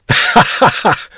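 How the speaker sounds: put-on old traveller shouting with laughter; shouted laughter. A narrator's reading. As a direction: bark the laugh out between gasps. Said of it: "shouted he again and again".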